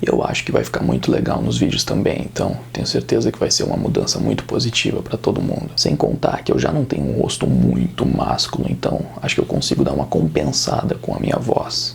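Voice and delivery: very deep, husky put-on tough-guy voice; mock action-series hero, low and rough; American action hero. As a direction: drop far down into a low action-protagonist growl. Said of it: "não vou ficar falando desse jeito".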